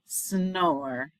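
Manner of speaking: plain reading voice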